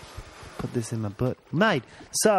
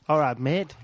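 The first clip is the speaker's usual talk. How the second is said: ordinary talk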